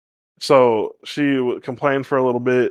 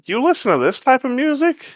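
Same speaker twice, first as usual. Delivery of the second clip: surprised coworker; 10 semitones higher than usual. Retold or imitated.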